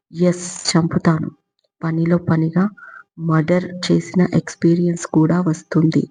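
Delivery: plain reading voice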